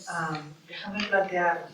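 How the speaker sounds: ordinary delivery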